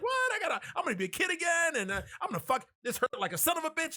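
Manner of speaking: high, fast mock rant; imitated ranting doll. Chucky suddenly talking after the fire threat, mock worked-up rant. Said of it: "he's just talking".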